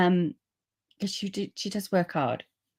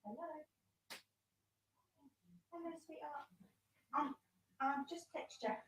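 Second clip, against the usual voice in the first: quiet, distant voice away from the mic; calling sweetly from the front door, off mic; far off at the door; 7 semitones higher, 6 dB quieter than usual. Real, not acted. Crafter greets her visitor at the front door, heard from afar. I've got to just answer the door.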